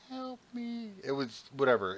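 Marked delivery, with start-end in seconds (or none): 0.1-0.9 high faint "Help me"; thin haunted plea; creepy toy voice. Retold or imitated. Host voicing a Furby in the closet at night, high and faint. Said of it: "it was just like".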